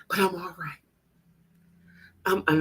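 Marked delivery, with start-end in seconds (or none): 0.1-0.8 imitating a tearful whisper, breathy and faint; a teary whisper mimicking her; mock emotional woman. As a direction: whisper it like someone barely holding it together. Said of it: "she was a little emotional".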